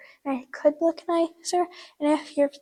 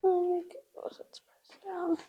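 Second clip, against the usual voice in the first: whispering to herself; 3 semitones higher than usual. Real, not acted. Braiding the tail, she whispers the steps to herself. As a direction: murmur the steps under your breath as you work.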